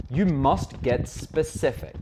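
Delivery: ordinary talk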